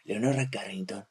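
hushed, soft documentary narration. Shown as a demonstration. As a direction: narrate the name like a nature film. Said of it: "esto es como de voz de documental".

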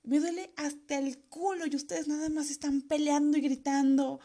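high, drawn-out exasperated tirade; acted fed-up rant. Injured daughter telling parents to shut up, acted angry rant. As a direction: pitch it up and stretch every complaint.

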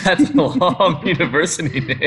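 laughing through the words; amused laugh breaking into the words. Real, not acted. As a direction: chuckle through it at the long name. Laughing at the long name.

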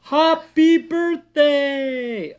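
very high, loud, slow drawn-out cheer; happy shout. A narrator's reading. Friends surprise Minnie, big slow happy shout. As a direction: yell it out long and joyful. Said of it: "They all shouted in one big, happy voice".

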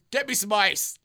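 high, loud diva demand; prima donna. Retold or imitated. Retelling injured friend demanding ice, prima donna. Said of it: "he goes full prima donna".

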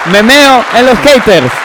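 high, slow, loud declaration; loud defiant slogan. Acted. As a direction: proclaim it like a title. Repeated slogan for title.

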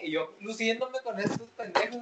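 normal talking voice

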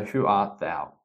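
ordinary talk, nothing marked

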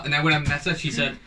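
ordinary talk

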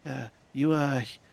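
a frightened captive, stammering; foreign woman voice. Acted. GM voices rescued woman Greta.

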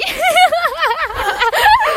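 delighted shrieking laugh; high squealing laugh. Real, not acted. Laughing at own joke. (hohes quietschendes Lachen)

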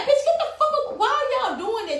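very high indignant protest; acted exasperation. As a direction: squeal it out in outraged disbelief. Acted protest at class intruders.

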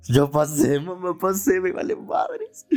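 high celebratory squeal, slowing at the end; júbilo desafiante. Retold or imitated. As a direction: crow it at mom, climbing very high. Retells gloating to his mother. (grito agudo y triunfal a su mamá)

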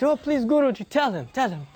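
high, eager begging; bouncing with excitement, pleading; excited pleading. Retold or imitated. Mother eagerly begging the guru again, high and excited. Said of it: "she comes very excited".

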